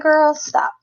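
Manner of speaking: high, incredulous brush-off; mock disbelief at a friend's suggestion; dismissive. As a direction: wave the idea away with a scoff.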